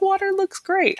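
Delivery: very high, chirpy mock verdict; high-pitched imitation. Practitioner approves her water result, high-pitched imitation.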